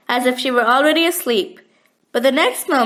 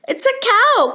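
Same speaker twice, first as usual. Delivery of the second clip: high, delighted cry of recognition; joyful cry; 9 semitones higher than usual. A narrator's reading. Archie hears the cow, cries out with joy. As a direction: greet it like an old friend. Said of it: "cried Archie with the joy".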